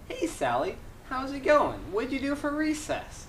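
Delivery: put-on high-pitched greeting to a small child; kindergarten teacher. Teacher greeting a pupil after recess, high sing-song.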